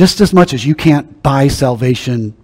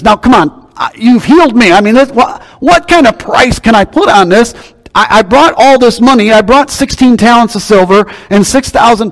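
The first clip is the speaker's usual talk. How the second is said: higher, louder eager plea; grateful healed man; 8 semitones higher, 4 dB louder than usual. Acted. Healed Naaman begging Elisha to take payment, higher and louder. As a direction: press the money on him, overwhelmed. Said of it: "and he says".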